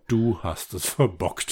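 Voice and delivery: slow, deadpan verdict; dry. Acted. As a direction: say it dryly, unimpressed. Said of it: "Und Justus nur trocken". (trocken und langsam)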